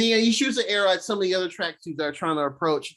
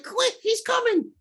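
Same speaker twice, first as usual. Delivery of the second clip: very high, drawn-out imitated call; stretched high-pitched retold alarm; mock urgent warning. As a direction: act out calling a warning to a partner. Voices Kate warning Clint.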